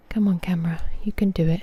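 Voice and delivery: whispered encouragement to the camera; coaxing whisper. Real, not acted. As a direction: whisper it like cheering on a shy pet.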